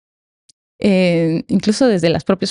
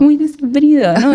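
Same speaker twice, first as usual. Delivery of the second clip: laughing, higher and louder; 7 semitones higher, 5 dB louder than usual. Real, not acted. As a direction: laugh through the words. Echoing the joke, laughing.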